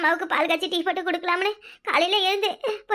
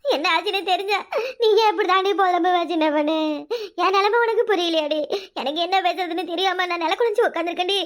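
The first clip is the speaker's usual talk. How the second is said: crying through the words; tearful. Acted. Woman begging friend to understand her plight, crying. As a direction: plead tearfully, ending in sobs. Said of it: "அழாம பேசுக்கா".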